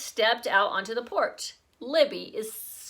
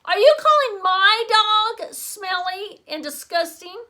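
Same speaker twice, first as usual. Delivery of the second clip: much higher, loud offended challenge; a boy defending his dog; indignant boy; 10 semitones higher, 9 dB louder than usual. A narrator's reading. Peter indignant retort.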